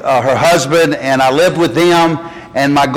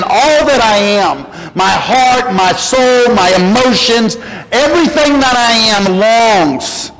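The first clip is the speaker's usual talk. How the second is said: building preaching; 6 semitones higher, slower than usual. Acted. Preaching the psalm with rising intensity.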